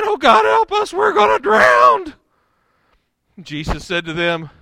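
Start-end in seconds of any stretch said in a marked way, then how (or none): 0.0-2.1 high, loud panicked yell; terrified shout. Retold or imitated. Disciples in the sinking boat yell for God's help. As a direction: scream it like you're sinking. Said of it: "they're saying".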